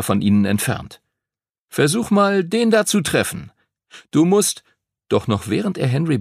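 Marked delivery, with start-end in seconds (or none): 1.7-4.6 much higher, keen coaching; eager instruction. A narrator's reading. Mark to Henry at the sling, higher and eager. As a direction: coach him keenly.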